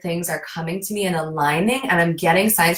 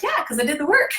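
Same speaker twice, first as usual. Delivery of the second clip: higher, light mock-proud; proud reply; 8 semitones higher, faster than usual. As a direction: say it like it's obvious. Retelling her proud answer.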